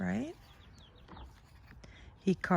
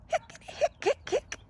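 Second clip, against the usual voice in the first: squeaky high hic sounds; high breathy hiccups. A narrator's reading. Skeleton hiccuping, high squeaky hics.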